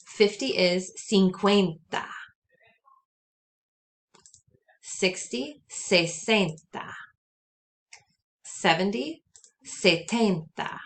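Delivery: Spanish tens stretched out slowly after each English number; slow model. Shown as a demonstration. Teacher models the tens slowly for students. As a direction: draw the Spanish out slowly for repeating. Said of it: "So repeat after me".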